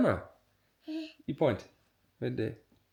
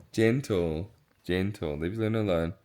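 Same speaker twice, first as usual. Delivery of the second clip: gentle coaxing; 6 semitones lower, 6 dB quieter than usual. Real, not acted. Parent soothing baby with dog.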